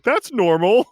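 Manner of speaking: high, loud puzzled squeak; baffled high squeak. Retold or imitated. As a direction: squeak it out in disbelief. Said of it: "I was like".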